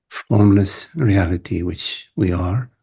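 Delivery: ordinary talk, nothing marked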